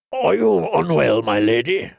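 knight's put-on voice, starting high and dropping; powerful knight. A narrator's reading. Knight asks the lady if she is unwell, in character. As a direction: question her in a strong-jawed knight's voice.

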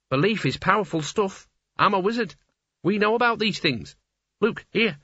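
an excited wizard explaining his craft; a lightly voiced fussy wizard; eager wizard. Excited wizard lecturing Mort.

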